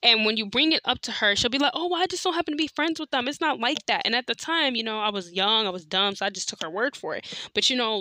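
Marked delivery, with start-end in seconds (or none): 1.7-4.1 dismissive excuse. Retold or imitated.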